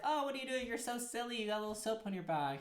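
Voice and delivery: high-pitched, affectionate mock scolding; playful teasing. Healthy partner spotting soap on her back, playfully teasing. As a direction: coo it high like a doting partner.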